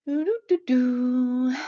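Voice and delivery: slow idle doo-doo hum; humming noises. Real, not acted. Author scrolling through chat comments, idly humming. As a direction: hum absently while scrolling. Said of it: "I'm making humming noises".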